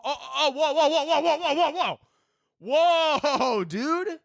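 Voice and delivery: mock shock. Stopping himself in mock shock.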